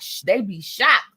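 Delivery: ordinary talk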